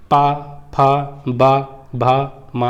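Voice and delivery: पा, फा, बा, भा, मा drawn out slowly, each syllable apart; slow lip sound demo. Shown as a demonstration. Teacher slowly demonstrates पा फा बा भा मा for students. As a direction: spell out पा फा बा भा मा slowly so students hear the lips close. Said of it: "आप इनको प्रैक्टिकल करके देख लीजिए".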